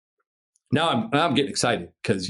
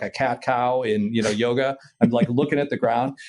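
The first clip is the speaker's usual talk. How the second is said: usual speaking voice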